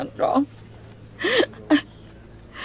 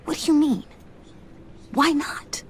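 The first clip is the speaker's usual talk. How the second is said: whisper; 4 semitones higher than usual. Acted. Whispered exchange at the funeral.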